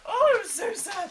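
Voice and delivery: high and loud gushing over a sad scene; emotional gush. Real, not acted. Telling viewers how sad the deaths were, high loud gush. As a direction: let it burst out, overcome.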